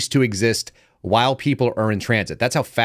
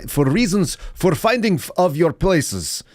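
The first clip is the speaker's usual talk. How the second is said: slow, higher mock Slavic villain accent; Dracula-like accent; 6 semitones higher than usual. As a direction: drag out a hammed-up Eastern European accent. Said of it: "accent that sounds like Dracula".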